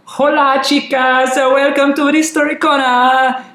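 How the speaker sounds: put-on high-pitched, drawn-out Catalan accent gone Italian; hammed-up attempt at a Catalan accent. Podcast host opening story corner, hammed-up Catalan accent. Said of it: "make this a Catalan accent".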